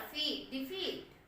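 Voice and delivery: फी then डिफीट, spoken slowly, long ee on फी; slow syllable demo. Shown as a demonstration. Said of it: "D में नहीं आ रहा".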